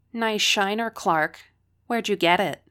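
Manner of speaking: loud, high mocking jeer across the room; taunting call. A narrator's reading. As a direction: call out to mock her bruise. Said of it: "Tony's voice came from the back".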